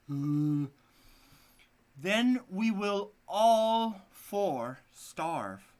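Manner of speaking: slow, loud, high scorn; scornful wife. Acted. The wife mocks her husband, slow and loud. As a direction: sneer it out slowly.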